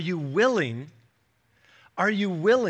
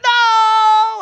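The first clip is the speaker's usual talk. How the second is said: put-on high loud wailing child's no; kid melting down; 12 dB louder than usual. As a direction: wail it like a toddler refusing bed. Kids refusing bedtime, melting down.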